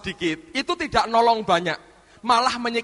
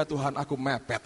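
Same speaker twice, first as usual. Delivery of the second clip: quiet confession; 9 semitones lower, 9 dB quieter than usual. Retold or imitated.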